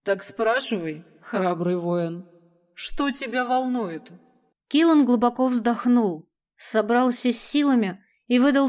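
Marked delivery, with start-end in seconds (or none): 0.0-4.1 softer, slow amused dragon. Acted. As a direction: let the dragon chuckle it out. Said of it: "Дракон усмехнулся".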